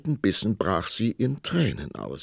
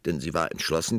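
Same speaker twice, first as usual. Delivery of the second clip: ordinary delivery, nothing marked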